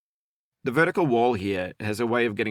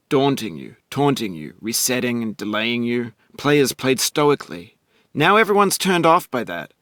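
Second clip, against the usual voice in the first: louder, rhythmic, near-chanted verse; loud rhyming rant; 3 semitones higher, 5 dB louder than usual. Acted. Narrator rallying the player against quitters, loud rhythmic chant. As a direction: punch out each rhyme like a pep rap.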